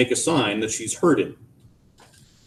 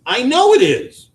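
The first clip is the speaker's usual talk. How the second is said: high, hard and louder; curt retort; 13 semitones higher, 6 dB louder than usual. A narrator's reading. Wife snapping at husband, high and hard. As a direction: cut him off.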